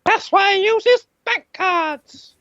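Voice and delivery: normal talking voice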